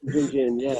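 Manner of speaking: high, eager mock cheer; cheering crowd. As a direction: join in like a happy pub crowd. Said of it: "And everyone goes".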